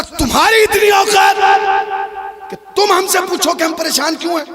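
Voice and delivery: king shouting down at his servant; haughty angry shout. Acted. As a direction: put the servant in his place with a loud outburst.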